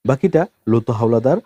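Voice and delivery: ordinary delivery, nothing marked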